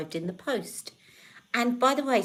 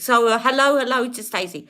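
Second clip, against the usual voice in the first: loud hello; 5 semitones higher, 11 dB louder than usual. Real, not acted. Calling out greeting.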